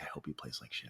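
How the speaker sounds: low, hushed bitter mutter; quiet resentful thought; muttered inner thought. Retold or imitated. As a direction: say it under your breath, bitter.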